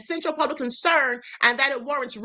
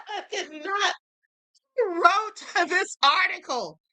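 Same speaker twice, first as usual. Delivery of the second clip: laughing; 4 semitones higher, slower than usual. Real, not acted. Laughing fit continues.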